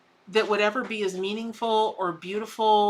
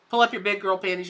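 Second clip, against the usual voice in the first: usual speaking voice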